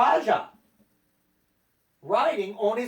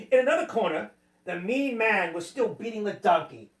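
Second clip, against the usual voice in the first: usual speaking voice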